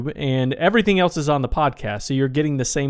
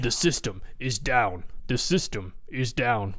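cartoon quote voice; 3 semitones lower, 9 dB quieter than usual. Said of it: "Homestar Runner".

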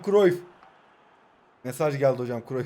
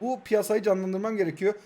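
ordinary talk, nothing marked

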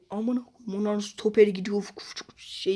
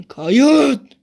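angry yell; 6 semitones higher, 12 dB louder than usual. Real, not acted. Sudden outburst at the game.

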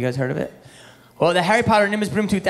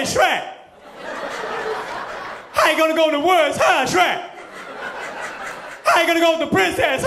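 Eddie Murphy as Donkey; 11 semitones higher than usual. Retold or imitated. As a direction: yell at Shrek like a cussing Donkey. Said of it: "waiting for him to be like".

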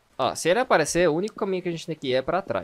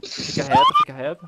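panicked shout; 6 dB louder than usual. Real, not acted. Panicked while chased in horror game.

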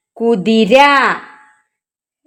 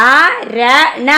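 അരണ stretched very slowly, syllable by syllable; read along slowly; much slower than usual. Shown as a demonstration. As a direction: model അരണ slowly for the kids to repeat. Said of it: "എൻ്റെ കൂടെ വായിക്കട്ടോ". (അരണ വളരെ പതുക്കെ, കൂടെ വായിക്കാൻ)

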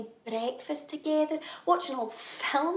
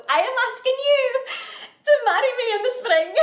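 loud, higher, in one determined rush; emphatic proposal; 5 semitones higher, 7 dB louder, faster than usual. Acted. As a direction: propose boldly, breaking towards a laugh. Insistent repeat of the proposal.

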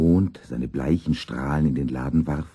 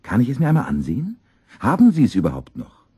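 fast, raised, eager questioning; excited student; 8 semitones higher, 6 dB louder, faster than usual. A narrator's reading. Music student asking shopkeeper to see the piano, excited. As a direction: fire off the questions in an excited rush. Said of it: "ganz aufgeregt hereingelaufen kam".

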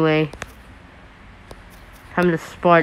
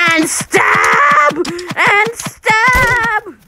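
battle yell; 13 semitones higher, much slower than usual. Real, not acted. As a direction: shout it, rising to a shriek. Yelling while charging.

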